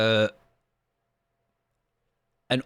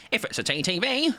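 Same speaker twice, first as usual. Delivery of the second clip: put-on higher voice, rising at the end; mimicked voice; 11 semitones higher than usual. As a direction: imitate his catchphrase, pitched up. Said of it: "I said it in that voice".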